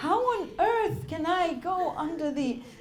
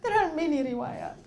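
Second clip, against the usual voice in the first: usual speaking voice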